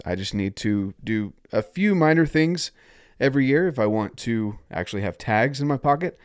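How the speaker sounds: normal talking voice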